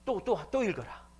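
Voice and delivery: high, loud, repeated command; acted stern preacher insisting; insistent order. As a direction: order it impatiently, pitch jumping up. Acting out Moody ordering rereading.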